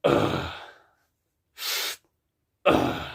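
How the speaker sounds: low growling grunt; growled ugh. Shown as a demonstration. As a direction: push a growl through the ugh.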